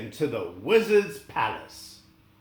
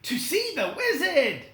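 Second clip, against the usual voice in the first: Dorothy replying; 12 semitones higher than usual. Acted. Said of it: "replied Dorothy".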